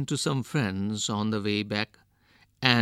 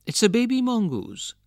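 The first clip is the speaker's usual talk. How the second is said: higher-pitched wife's exclamation; mildly excited exclamation; 10 semitones higher, 4 dB louder, faster than usual. A narrator's reading. Wife reacts to the baby mongoose.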